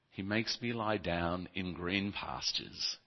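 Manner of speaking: soft, slow psalm reading; scripture reading. Acted. Preacher reads the psalm softly to the congregation. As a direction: read it gently, savouring each line.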